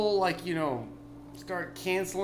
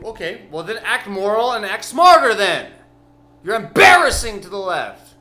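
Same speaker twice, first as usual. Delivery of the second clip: much louder ranting yell; angry shout; 6 semitones higher, 11 dB louder than usual. Real, not acted. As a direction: let rip at them. Yelling at the left.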